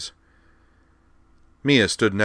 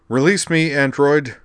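louder, higher, furious command; snarled; 8 semitones higher, 8 dB louder than usual. Acted. Amanda restrained by Dorothy, snarling in rage. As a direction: snarl at the robot holding you. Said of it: "Amanda snarled".

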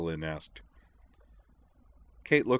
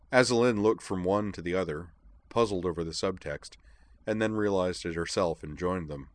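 ordinary delivery, nothing marked

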